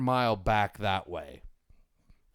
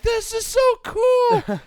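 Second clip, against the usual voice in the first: put-on high, loud kid cheering; thrilled shout; 8 dB louder than usual. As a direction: yell it out in pure delight. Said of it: "And I'm like".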